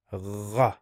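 gha rasped at the back of the throat like a French r; gha with phlegm in the throat; gargled gha. Shown as a demonstration. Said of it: "right like this".

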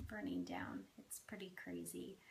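hushed whisper about the fire. Real, not acted. To viewers about the town burning, whispered. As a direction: drop to a breathy whisper, stunned.

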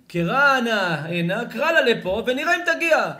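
high, loud theatrical summons; calling out loudly. Acted. Friend demands he summon the girl over, calling out. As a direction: holler it out as a challenge and a call. Acted summons to the supposed daughter.

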